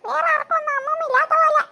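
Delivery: hammed-up tearful grumble about grandma; crying. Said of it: "എന്താ കരഞ്ഞോണ്ട് വരുന്നേ".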